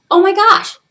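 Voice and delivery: ordinary talk, nothing marked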